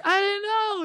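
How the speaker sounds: put-on very high, small girlish voice; lightly voiced young host; mock host. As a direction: answer meekly as the host. Host answering owner.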